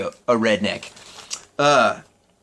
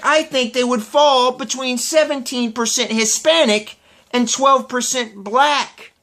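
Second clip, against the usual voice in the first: mocking crackpot; 6 semitones higher than usual. Imitating crackpot woman's wrong answer, mocking voice. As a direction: play a clueless quiz taker. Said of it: "these crackpots".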